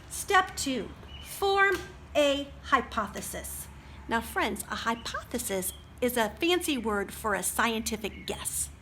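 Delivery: usual speaking voice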